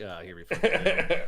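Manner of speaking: low, loud, laughing grumble; low laughing mutter of a weary fighter; gruff in-character grumble. Acted.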